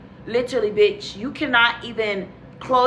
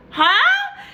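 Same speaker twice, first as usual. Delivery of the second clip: very high, loud, baffled huh; confused huh; 5 dB louder than usual. Retold or imitated. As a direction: blurt it out, completely thrown. Total confusion.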